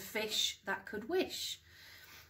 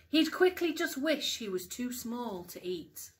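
lively storytime voice with big rises on the wishes; bouncy picture-book narration, hushed at the end; reading to children; 5 dB louder than usual. Acted. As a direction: read it like bedtime storytime for young kids. Mum reads picture book aloud.

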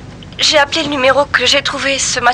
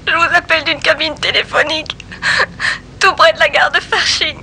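tearful call from a payphone; sobbing through the words; crying on the phone; 14 semitones higher, 4 dB quieter than usual. Acted. Arrêtez de pleurer. (en larmes au téléphone)

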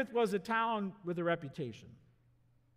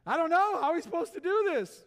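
high, loud and flustered; excited; 9 semitones higher, 6 dB louder than usual. Acted. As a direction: blurt it out in a panic. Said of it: "He's really excited".

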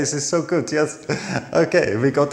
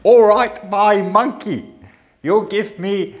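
high, cheeky London cockney put-on; mock London accent; 6 semitones higher, slower than usual. Tourist imitating a Londoner, hammed-up cockney accent. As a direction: play a chirpy London market trader in a cockney accent. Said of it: "And London".

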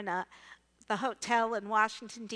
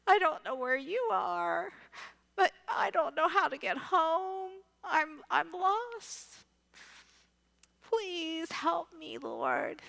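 pleading prayer to the Lord, higher and slow; retold tearful plea, drawn out and high; crying prayer; 5 semitones higher, slower than usual. Stranded teacher prays to the Lord while lost, tearful and pleading. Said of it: "And I'm crying, going".

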